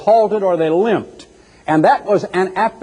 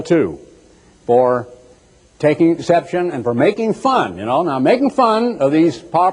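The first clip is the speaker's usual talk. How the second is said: usual speaking voice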